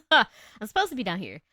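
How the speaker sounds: loud, swooping line after a laugh; laugh, then play it up loudly; laughing, exaggerated. Shown as a demonstration. Streamer joking about her alto range, loud and laughing. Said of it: "My voice is supposed to be low".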